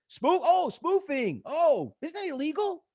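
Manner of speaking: high, feigned shock at "spoofing"; mock gasp of innocent outrage; sarcastic surprise. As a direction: play dumb to expose the claim. Mocks analyst's spoofing claim.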